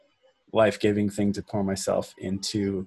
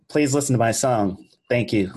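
put-on radio presenter, projected; 3 semitones higher, 4 dB louder than usual. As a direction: introduce a song like a hammed-up radio host. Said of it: "use your best radio voice".

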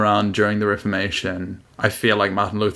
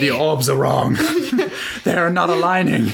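Marianne Williamson impression; 6 semitones higher than usual. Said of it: "That's Marianne Williamson".

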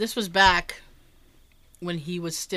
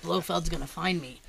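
confiding Roger Moore; 5 semitones lower, 6 dB quieter than usual. Retold or imitated. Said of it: "He's like".

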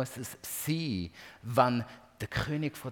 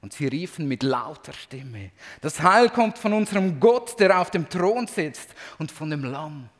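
loud, high, proclaiming reading of the heavenly praise; scripture reading; 4 semitones higher, 8 dB louder than usual. Acted. Preacher reading Revelation to congregation, loud proclaiming voice. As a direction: read the vision as if shouting praise with the crowd. Reads Revelation aloud with fervor.

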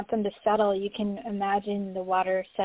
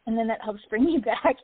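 ordinary talk, nothing marked

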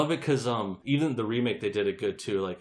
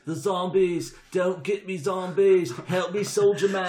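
mock panicked victim; 7 semitones higher, 4 dB louder, slower than usual. Mocking the film's characters.

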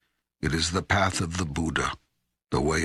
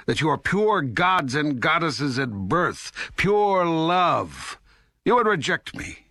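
raised pitch, building in exasperation; indignant rebuke; 9 semitones higher than usual. Acted. God rebuking humans over their religions, indignant. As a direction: press the charge home, voice climbing.

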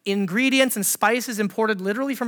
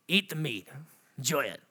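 low, soft, weary grumble; a hint of an exasperated dad; fed-up parent; 6 semitones lower, 8 dB quieter than usual. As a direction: mutter it like a tired parent.

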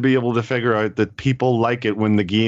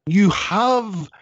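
exasperated; 6 semitones higher, much slower than usual. Real, not acted. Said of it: "that anger I mentioned".

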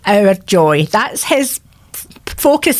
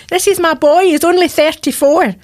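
anguished plea; 8 semitones higher than usual. Retold or imitated. Mother's anguished prayer retold.

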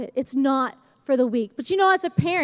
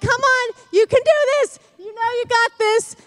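cheering parents; 8 semitones higher, 5 dB louder than usual. Retold or imitated. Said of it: "We're like".

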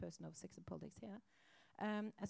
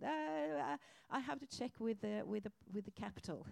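nervous, hesitant stalling; flustered stammer; 2 semitones higher, 6 dB louder than usual. Retold or imitated. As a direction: stammer like a cornered diplomat. Poor ambassador flustered, stalling.

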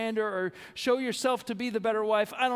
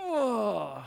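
anguished groan. Shown as a demonstration. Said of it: "you're at a point of saying".

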